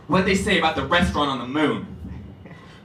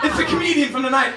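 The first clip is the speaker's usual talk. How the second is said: ordinary talk, nothing marked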